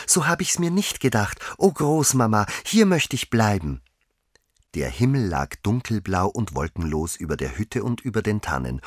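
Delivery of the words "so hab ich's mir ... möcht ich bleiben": put-on high girlish voice full of wonder; delighted exclamation. A narrator's reading. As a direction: marvel aloud in delight. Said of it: "rief sie immer wieder". (entzückt, mit hoher Mädchenstimme)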